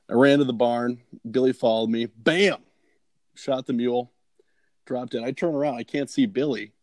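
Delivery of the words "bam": punchy, higher-pitched bam; imitated gunshot. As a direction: bark out a single rifle shot. Imitates his rifle shot in story.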